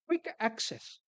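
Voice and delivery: emphatic "quick", then "access"; stress on QUICK. Real, not acted. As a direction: lean on the word "quick". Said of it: "I emphasize the word quick".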